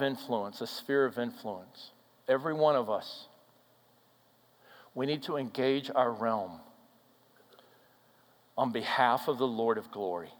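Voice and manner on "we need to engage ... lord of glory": ordinary delivery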